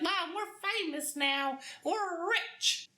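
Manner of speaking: put-on giddy teen daughter. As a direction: gush like a thrilled teenager. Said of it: "She's like".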